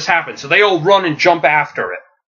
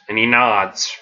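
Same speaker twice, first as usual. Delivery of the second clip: ordinary delivery, nothing marked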